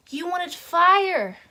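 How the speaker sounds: high, slow, very loud bellow; hammed-up yell with stretched words; theatrical shout. Shown as a demonstration. Overacted take on the monologue.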